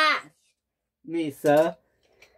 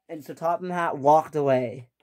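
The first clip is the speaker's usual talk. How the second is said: plain reading voice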